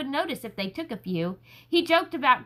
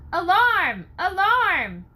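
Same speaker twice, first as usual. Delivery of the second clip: high, loud town crier's call; alarm shout; 6 semitones higher, 6 dB louder, slower than usual. Acted. Crier wakes the town at night, loud alarm shout. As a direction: cry out the alarm to the whole town.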